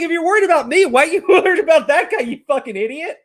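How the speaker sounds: mock disbelief at an idiot; exasperated mockery. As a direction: scoff at her with a laugh. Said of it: "I'm like".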